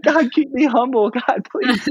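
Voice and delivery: high, comic little prayer; mock pleading. Speaker praying after praise of the cookies, high mock plea. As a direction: plead upward in a squeaky voice.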